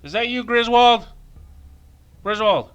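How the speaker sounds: loud, high mock call. Player calling out to Griswold, loud high call. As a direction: holler for someone out of sight.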